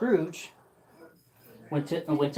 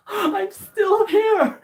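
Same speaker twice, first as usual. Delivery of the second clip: laughing shout; 14 semitones higher, 7 dB louder, much slower than usual. Acted. Scrooge laughing with joy.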